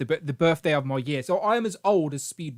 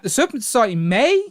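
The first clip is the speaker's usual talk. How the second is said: MAY pitched high and rising; really high-pitched; 5 semitones higher, 5 dB louder than usual. Shown as a demonstration. Host hedging a rumour to Brandon, really high-pitched MAY. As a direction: lift "may" right up, doubtfully. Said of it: "I say that with a really high pitched voice".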